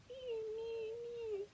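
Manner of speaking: very high kitten mews; mewing kitten. Pretend. Reading to a child, kitten mews high. Kitten noise in bedtime book.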